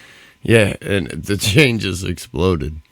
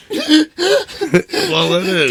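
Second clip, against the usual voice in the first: high laughing reply; 5 dB louder, much slower than usual. Real, not acted. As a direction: laugh through the words.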